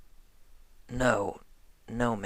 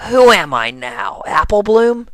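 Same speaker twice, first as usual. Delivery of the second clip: louder, rising up high on the name; playful loud challenge; 8 semitones higher, 7 dB louder than usual. Acted. AJ teasing Rainbow, louder and higher. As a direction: call her out teasingly.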